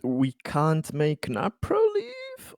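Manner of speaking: usual speaking voice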